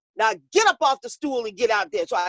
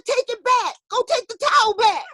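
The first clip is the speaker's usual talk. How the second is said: frantic; 11 semitones higher than usual. Retold or imitated. Boxer demands towel back.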